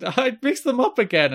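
high-pitched mock whine; Liam. Co-host mimicking his confused friend, high and flustered. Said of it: "And Liam's like".

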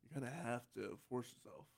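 forced-low, hushed voice; speaking down low. Shown as a demonstration. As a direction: drop down low and quiet. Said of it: "trying to speak down low here".

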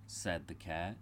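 ordinary delivery

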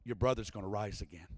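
stone cold. Retold or imitated. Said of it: "Jesus, just stone cold".

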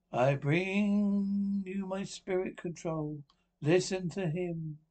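slow, drawn-out summoning; stretched séance chant; medium in trance. Acted. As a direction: intone as if calling up a spirit. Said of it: "I'll go into trance".

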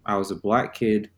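normal talking voice

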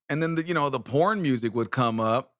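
ordinary talk, nothing marked

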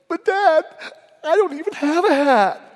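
high, loud tearful whine, hammed up; crying six-year-old daughter. Daughter protesting to her dad from the back seat, crying and whining. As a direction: mimic a little girl wailing in protest. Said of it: "She was crying and whining".

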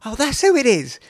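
high, surprised oh; sudden realisation. Retold or imitated. Telling a friend he recognised Houston, high surprise. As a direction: realise it all at once. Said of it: "And I was like".

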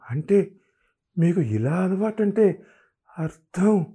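hesitant shocked stammer; stammering question. A narrator's reading. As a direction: ask haltingly, stunned. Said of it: "తడబడుతూ అడిగింది".

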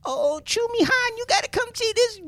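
put-on high old lady calling, far up in pitch; high-pitched grandma imitation. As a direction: play step-grandma Luella excited to show him. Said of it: "She's like".

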